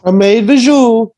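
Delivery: high, loud, slow falsetto; teasing his co-host in the falsetto mom voice; mock mom voice. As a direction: echo the squeaky mom voice as a joke. Mocking the soup-mom voice.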